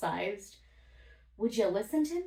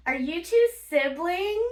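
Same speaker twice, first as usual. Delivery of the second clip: high, loud curious gasp; nosy stranger on the plane, breathless curiosity; gasping nosy lady; 9 semitones higher, 8 dB louder than usual. Retold or imitated. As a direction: gasp and ask, much higher and louder. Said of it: "this lady behind us is like".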